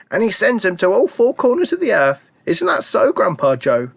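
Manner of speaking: put-on a proud old grandma chiming in. A narrator's reading. Grandma Josephine boasting to Charlie, high old voice.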